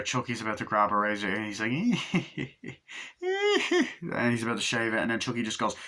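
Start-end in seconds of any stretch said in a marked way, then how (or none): 1.8-4.0 high, far-off evil giggle; evil doll laugh. Retold or imitated. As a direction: cackle like a killer doll. Chucky laughs before the kill.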